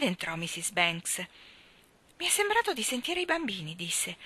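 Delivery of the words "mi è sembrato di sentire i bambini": worried mother. A narrator's reading.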